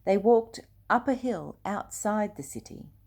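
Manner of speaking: plain reading voice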